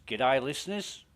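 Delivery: self-mocking Australian radio host greeting; cheery Australian DJ hello, rising; Australian accent. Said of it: "my Australian accent's shit".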